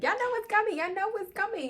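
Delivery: very high excited chant; giddy singsong tease. Real, not acted. Recapper teasing viewers about what comes next, giddy and high.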